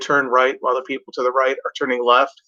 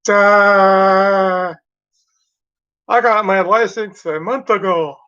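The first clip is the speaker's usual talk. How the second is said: higher, louder dopey voice; dumb new driver; 5 semitones higher, 4 dB louder, much slower than usual. Retold or imitated. Mocking the new driver in front, dopey. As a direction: play the clueless new driver, hammed-up. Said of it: "sitting there going".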